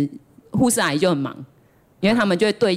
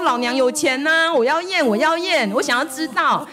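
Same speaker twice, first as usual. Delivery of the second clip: defiant; 6 semitones higher than usual. Retold or imitated. Retold inner defiant reply.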